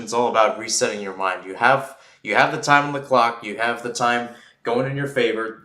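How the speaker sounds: ordinary talk, nothing marked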